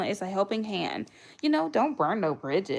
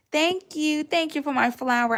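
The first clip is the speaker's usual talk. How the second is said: high, louder thank you; delighted thanks; 7 semitones higher, 4 dB louder than usual. Real, not acted. Thanking a viewer for a flower gift, delighted and high. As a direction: beam through the thanks.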